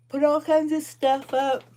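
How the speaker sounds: yawning. Real, not acted. Crocheter telling viewers about her errands, yawning as she talks. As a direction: push the words out through a big yawn. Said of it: "Sorry that I'm yawning".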